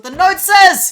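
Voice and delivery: loud, high excitement; bursting with excitement; excited shout. Real, not acted.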